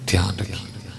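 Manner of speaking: دھیان رکھیے breathed as a soft whisper. Shown as a demonstration. Preacher whispers دھیان رکھیے to the congregation as a warning.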